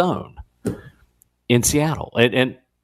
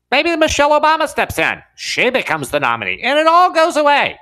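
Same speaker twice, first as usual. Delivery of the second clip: high-pitched imitation of a wishful voter; mock hopeful voter; 13 semitones higher than usual. Host mimics voters all over the map, high mock voice. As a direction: put on a high voice for someone's pet theory. Voicing voters' scattered hopes.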